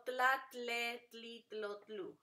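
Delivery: "tla, tle, tli, tlo, tlu" in even beats; syllable drill. Shown as a demonstration. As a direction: keep t and l together in "tla". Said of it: "con eses de T L".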